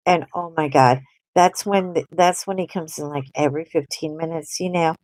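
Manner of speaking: ordinary talk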